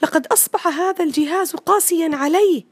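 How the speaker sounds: put-on high complaining child voice; boy complaining about his computer. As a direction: whine like a trapped kid. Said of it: "تابع وائل كلامه".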